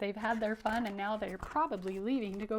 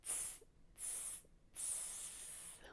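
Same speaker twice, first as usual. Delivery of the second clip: teasing monkey "tss" hisses; three soft voiceless hisses, last one drawn out; monkey chatter; 25 dB quieter, much slower than usual. Shown as a demonstration. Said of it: "Can you do it?".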